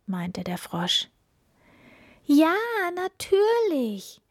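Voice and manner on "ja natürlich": high, drawn-out joyful exclamation; happy cry. A narrator's reading. Princess accepting the frog's offer, happy cry. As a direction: call it out with delight, much higher. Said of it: "rief die Prinzessin froh".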